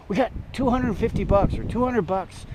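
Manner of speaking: high, eager mock offer of cash; imitating a persuasive friend; tempting offer. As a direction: dangle the money to win him over. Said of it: "he goes".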